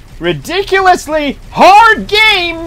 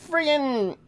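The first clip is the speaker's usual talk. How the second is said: ordinary talk, nothing marked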